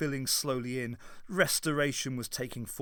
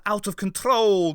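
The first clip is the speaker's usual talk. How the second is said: goading; 6 semitones higher, 8 dB louder than usual. A narrator's reading. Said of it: "goaded the Doctor".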